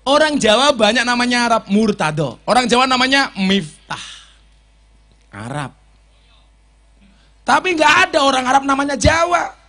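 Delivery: loud, high sermon delivery; fiery preaching. Acted. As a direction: preach it at full force. Preaching at full force.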